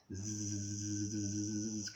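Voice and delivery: long steady bee buzz. Acted. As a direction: imitate bees with a sustained zzz. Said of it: "buzzy bees buzzing around the flowers".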